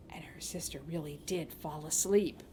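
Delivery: hushed, low whisper; quietly telling that the sister slept. A narrator's reading. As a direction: whisper so she stays asleep. Narrator hushes after lullaby.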